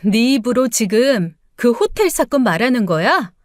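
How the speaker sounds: usual speaking voice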